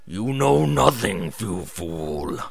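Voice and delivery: low, menacing hiss dropping at the end. Acted. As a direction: hiss it like something dark inside her. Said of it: "Moon Shadow lowly hissed".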